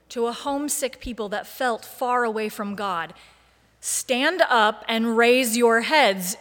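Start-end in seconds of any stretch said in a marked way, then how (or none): 3.8-6.4 loud, slow teacher's command, part exasperated, part encouraging; rally them slowly and loudly like a teacher. Acted. Jesus exhorting a homesick people, in an exasperated, encouraging teacher voice. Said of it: "using his best teacher voice in a tone that is equal parts exasperation and encouragement".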